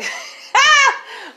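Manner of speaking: a real burst of laughter, loud and high. Real, not acted. Mother laughing as she tells of her son, a loud, high laugh. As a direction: break into a laugh at her son's cheek.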